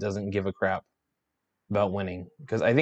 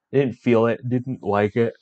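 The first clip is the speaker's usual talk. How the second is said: yawning; 4 dB louder than usual. Real, not acted. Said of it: "sorry to keep yawning".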